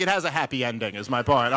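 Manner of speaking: ordinary delivery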